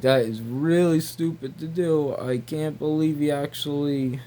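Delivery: ordinary talk, nothing marked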